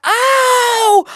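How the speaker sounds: long, high-pitched loud "ow"; mock scream of pain; pained yelp. Retold yelp on seeing injured foot.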